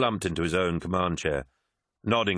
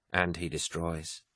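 whispered; 5 dB quieter, slower than usual. A narrator's reading. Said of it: "whispered Kassar".

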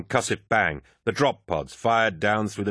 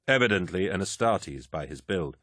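ordinary delivery, nothing marked

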